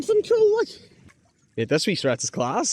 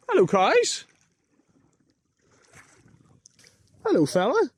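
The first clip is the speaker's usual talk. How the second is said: high sing-song hello to the cows; high, cheery animal greeting; talking to animals; 7 semitones higher than usual. Real, not acted.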